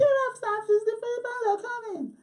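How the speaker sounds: very high put-on woman's alarm; falsetto yell. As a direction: sound the alarm in a hammed-up falsetto. Woman's yelled alarm acted again.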